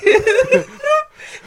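high maniacal cackle; evil villain laugh. Pretend. Villain laugh inside threat.

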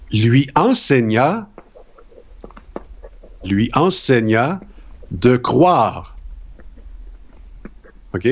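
slow dictation with punctuation spoken; dictation reading. Acted. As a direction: read it like a teacher's dictée. Reads a dictation aloud.

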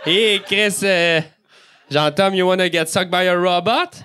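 ordinary delivery

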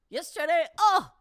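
high, loud yelp of shame; anguished outburst. Acted. Anguished admission.

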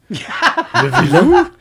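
very high, loud, drawn-out mock call; hammed-up host imitation. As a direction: stretch it into a high mock shout. Co-host joins the impression, laughing. (imitation criée, aiguë et étirée)

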